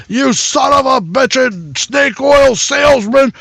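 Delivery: high, loud furious tirade; raving mad. Acted. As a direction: rage at Merlin at the top of your voice. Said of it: "he's just raving mad".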